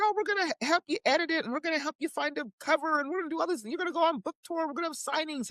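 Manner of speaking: high, excited sales gush; eager promises. Retold or imitated. Publisher promising the new author everything, gushing eagerly.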